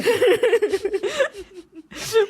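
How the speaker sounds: high, breathless giggle fit; high giggling fit. Real, not acted. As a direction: burst into squealing laughter.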